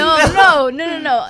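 loud, high repeated no; frantic refusals. Retold or imitated. Girl stopping the medic from seeing her socks, loud and high. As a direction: stop him, loud and quick.

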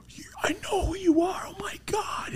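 high, hushed, breathless fan whisper; starstruck gushing. Retold or imitated. Imitating his friend meeting the Dodger legend, starstruck. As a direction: gush in a high mock whisper.

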